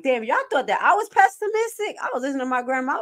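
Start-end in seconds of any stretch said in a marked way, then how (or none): 0.0-1.9 high mocking jab at herself; lightly voiced relative teasing; mock family voice. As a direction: tease her like the family would. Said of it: "They'll be like".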